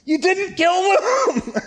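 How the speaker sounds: hammed-up furious prophet, giggling; laughing mock outburst. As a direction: shout it while cracking up at him. Jonah arguing with God in town.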